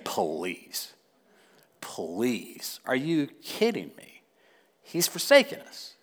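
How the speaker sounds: low, soft, dripping sarcasm; imagined Gideon, quietly sarcastic and unimpressed. Retold or imitated. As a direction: scoff at the Lord in disbelief. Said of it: "sarcastic tone when he said it".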